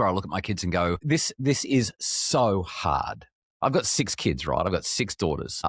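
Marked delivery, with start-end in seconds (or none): 1.0-3.3 very slow, drawn-out weary lament; weary sigh. Retold or imitated. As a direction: stretch it out like you're worn down. Retold moment of looking at the kids.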